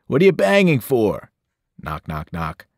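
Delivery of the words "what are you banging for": louder, higher angry yell; irritated trucker shout. A narrator's reading. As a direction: bark at Eddie outside. Maurice yelling back.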